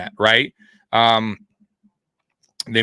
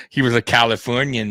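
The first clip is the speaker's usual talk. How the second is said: ordinary talk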